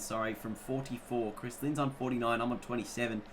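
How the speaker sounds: ordinary delivery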